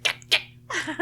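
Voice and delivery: high yapping imitation of mom; mock chihuahua bark; yappy chihuahua. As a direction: yap like an angry little dog. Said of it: "She's like a chihuahua".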